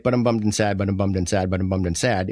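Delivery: low, repetitive glum plea; bummed and sad. Acted. Unheard friend repeating the problem to a helper, low and glum. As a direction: mope it lightly, over and over. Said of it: "that person who is like".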